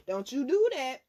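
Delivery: stern loud caution to the listeners; firm warning. Real, not acted. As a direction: warn them sharply. Warning the audience, said twice.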